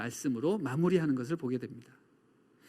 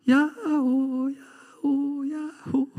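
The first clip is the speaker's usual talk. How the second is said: high, slow calls fading away; be a mountain echo, each repeat fainter; drawn-out echoing holler dying off; imitated echo; 7 semitones higher, much slower than usual. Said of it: "이런 메아리가, 아, 들려옵니다".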